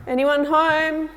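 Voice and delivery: high, loud, drawn-out call. Real, not acted. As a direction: call slowly into an empty cell block. Calling out to spirits while knocking.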